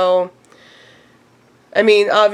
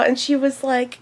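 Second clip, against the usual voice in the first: normal talking voice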